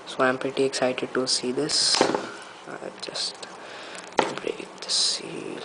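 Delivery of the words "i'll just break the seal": slow whisper while breaking the seal. Real, not acted. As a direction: hush it, slowly, under your breath. Quietly opening the box seal.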